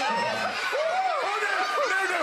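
high, squealing laugh-filled oh; a high squeal of laughter; bursting laugh. Real, not acted. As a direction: break into giggles you can't hold in. Gives in to laughter.

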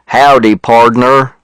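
put-on cowboy twang, stretched out; joking cowboy greeting. A narrator's reading. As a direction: play a slow, jokey cowboy drawl. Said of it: "which is a joke he does".

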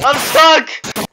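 loud, high cry of being stuck; alarmed shout. Real, not acted. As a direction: yell it out to teammates. Calls out being stuck in game.

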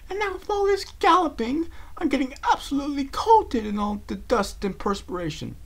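fussy fashionista fretting; Rarity voice. Acted. Rarity frets about the dust while galloping.

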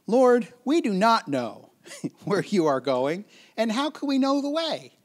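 exaggerated bewildered questioning; Thomas. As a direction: play up the clueless protest with a laugh breaking in. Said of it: "good old Thomas".

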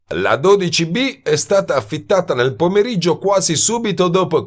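barge in and sneer; insolent intruder. A narrator's reading. Man walks in on couple, smug and insolent.

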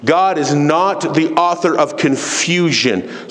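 slow and deliberate, weight on each word; slow, emphatic repeat. Real, not acted. Preacher repeats the verse to the church, slow emphasis. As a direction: drive the verse home slowly.

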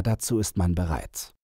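plain reading voice

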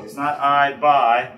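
"I buy", both words with a long I, slow and loud; wrong pronunciation demo. Shown as a demonstration. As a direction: drag out "I buy" as the mistake. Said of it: "It's a bye".

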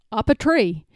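usual speaking voice

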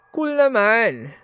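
high, loud, slow delight; excited. Real, not acted. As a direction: gush over it. Impressed by sneaking candy.

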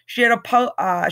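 ordinary delivery

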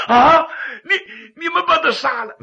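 high, shaky horrified question; aghast. Acted. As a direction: recoil in terror. Magistrate aghast.

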